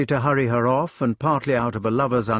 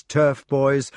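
ordinary delivery, nothing marked

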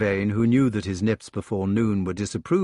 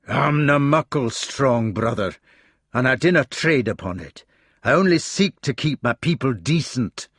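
firm, dignified Scottish reply; Scottish accent; 3 semitones higher, 3 dB louder than usual. Acted. Gawain answering Mordred, dignified Scottish accent. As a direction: answer with wounded dignity in Scots. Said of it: "He still kept his outland accent".

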